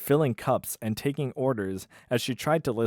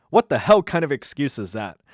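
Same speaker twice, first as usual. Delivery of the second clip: louder, scornful bark; angry boss; 3 semitones higher, 7 dB louder than usual. Acted. Manny to Delaney, a loud scornful snap. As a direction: scoff at the lame excuse. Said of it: "I'll do the voices".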